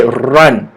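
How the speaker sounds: "run" low with a clear R; careful R sound. Shown as a demonstration. Teacher corrects the L habit, clear R in "run". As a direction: start "run" on a strong R. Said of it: "a lot of you will pronounce L S R".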